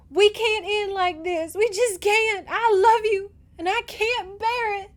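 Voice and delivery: very high, loud, desperate love plea; anguished pleading. A narrator's reading. Girl pleads with departing lover, anguished. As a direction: beg with your heart breaking, voice way up. Heroine begs her lover to stay.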